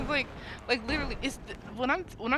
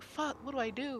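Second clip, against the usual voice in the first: higher-pitched, mildly panicked question; retold panic; 7 semitones higher than usual. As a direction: replay a touch of panic. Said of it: "I'm panicking".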